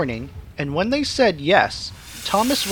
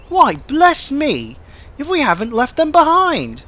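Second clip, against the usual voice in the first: discover the mistake with a start; surprised; 8 semitones higher, 4 dB louder than usual. A narrator's reading. Thomas realising he left his coaches, higher startled voice.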